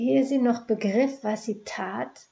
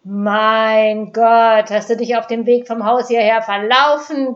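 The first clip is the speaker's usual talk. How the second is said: loud scornful bark; 11 dB louder than usual. Acted. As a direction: bark it at her with contempt. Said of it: "bellte Dieter".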